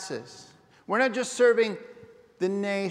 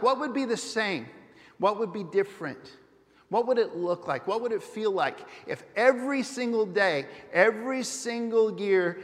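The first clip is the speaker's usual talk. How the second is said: ordinary talk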